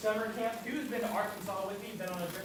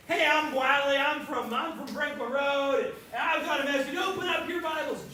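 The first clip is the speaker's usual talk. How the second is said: higher, much louder eager announcement; bold kid preacher; 5 semitones higher, 6 dB louder than usual. Retold or imitated. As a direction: burst up there and claim the stage. Kid boldly grabs the platform.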